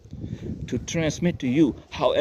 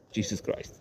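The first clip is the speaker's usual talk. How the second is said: under-breath whisper; 3 semitones lower, 10 dB quieter than usual. Real, not acted. Exasperated aside under breath.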